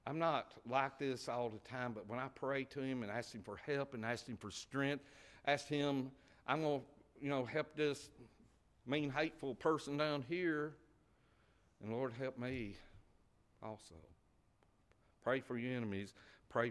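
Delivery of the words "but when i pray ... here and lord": usual speaking voice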